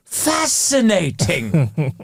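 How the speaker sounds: very slow, relished posh 'fascinating'; posh keen interest. Retold or imitated. Posh person who likes you, slow intrigued drawl. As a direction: draw it out as if truly intrigued. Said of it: "That means I'm actually interested in you".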